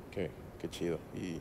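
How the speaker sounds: very low, soft mumble; awkward small talk. Retold or imitated. Son making conversation with father, awkward and subdued. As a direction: let the reply die away awkwardly.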